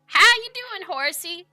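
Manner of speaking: high, loud cheery hello to a horse; bright cowboy howdy to a horse; cheery greeting. Acted.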